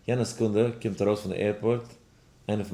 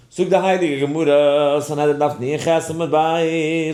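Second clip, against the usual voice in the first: loud, drawn-out learning chant; declaiming Gemara; 6 semitones higher, 10 dB louder, slower than usual. Shown as a demonstration. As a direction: raise it high, stretching the phrases. Opens a Gemara quotation aloud. (הויכער גמרא־ניגון)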